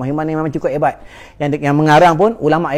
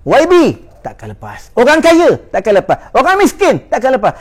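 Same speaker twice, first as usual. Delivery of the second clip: fiery warning; 7 semitones higher, 3 dB louder than usual. Acted. Preacher's escalating warning list.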